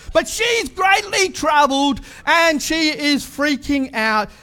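slow, high, shouted panic; mock panic, high and loud; freaking out. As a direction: play up Mary's alarm, drawn out. Preacher acting out panicked Mary.

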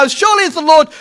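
disillusioned widow, edged "your"; bitter doubt. Shown as a demonstration. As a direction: say it as someone who no longer believes. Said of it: "seen too much sickness and death".

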